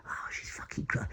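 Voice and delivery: hushed, under the breath; whispered thought. Retold or imitated. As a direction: whisper it to yourself in alarm. Private thought, watching his wife.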